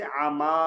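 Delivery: आमा with an open, unrounded long "aa"; thin alif. Shown as a demonstration. Teacher contrasting for students, आमा with thin long aa. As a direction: keep आमा light and hold the final aa. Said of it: "फिर बारीक होगा".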